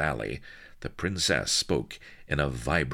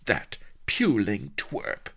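higher, slow contemptuous sneer; 10 semitones higher, slower than usual. Acted. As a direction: sneer the insult. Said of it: "sneered Sheba".

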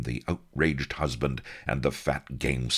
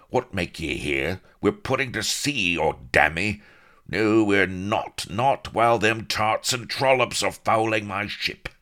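loud, high, bellowing skipper; angry captain shouting, louder toward the end; furious sea captain; 7 semitones higher, 5 dB louder than usual. A narrator's reading. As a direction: rage at the women fouling the ship. Captain's voice in story.

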